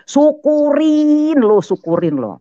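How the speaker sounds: loud, high, drawn-out gloating; mengejek sambil tolak pinggang. Retold or imitated. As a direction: scold with a long mocking first word. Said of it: "saya tolak pinggang, berdiri, saya bilang gini". (mengejek keras dengan nada tinggi memanjang)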